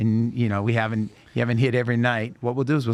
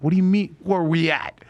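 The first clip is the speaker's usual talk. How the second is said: surprised disbelief; 6 semitones higher than usual. Retold or imitated. To the singer who forgot the invite, startled. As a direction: caught off guard, voice jumps up. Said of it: "I'm like".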